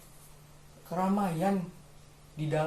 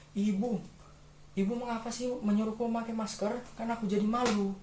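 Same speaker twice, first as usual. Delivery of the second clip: put-on high-pitched, plaintive child voice; complaining child; 7 semitones higher than usual.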